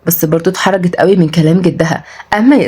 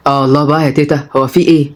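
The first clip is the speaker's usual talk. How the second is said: low, mildly put-upon man; grumbling low-pitched grandson; complaining grandson; 4 semitones lower than usual. Acted.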